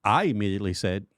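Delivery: normal talking voice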